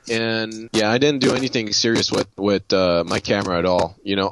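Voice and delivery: ordinary talk, nothing marked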